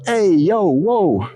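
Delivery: a cartoonish New Yorker yelp; New Yorker impression. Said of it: "a New Yorker".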